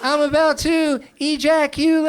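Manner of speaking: very high, slow, loud hammed-up cry; shrieking mock climax; mock ecstatic shout. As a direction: belt it out like an over-the-top lover. Too many syllables example.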